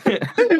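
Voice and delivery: a squeaky little laugh; high giggle. Real, not acted. Giggle after silly voice.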